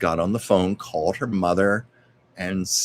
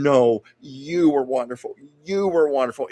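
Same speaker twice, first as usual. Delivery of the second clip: louder, higher, insisting on the compliment; effusive praise; 6 semitones higher, 4 dB louder than usual. Retold or imitated. Lucy praising Desi right back, effusive and insistent. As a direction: gush it back, imitating mutual admiration. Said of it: "they were just praising each other".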